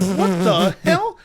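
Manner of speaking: slow, laughing-through-it "what the hell"; amused and baffled at once; laughing disbelief. Retold or imitated. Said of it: "I was like".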